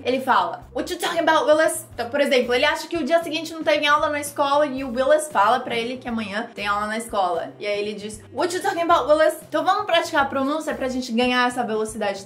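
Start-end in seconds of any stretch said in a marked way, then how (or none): 0.7-1.9 fast, miffed outburst, slurred together; Arnold. Acted. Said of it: "muito rápido, que nem ele, que tá invocadinho". 8.3-9.4 fast, miffed outburst, slurred together; Arnold. Acted. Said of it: "que tá invocadinho também".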